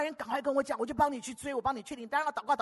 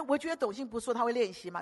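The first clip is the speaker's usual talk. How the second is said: normal talking voice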